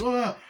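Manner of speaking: short high startled yelp. Retold or imitated. Laurie bumping into a man, high startled yelp. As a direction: yelp as if bumped into.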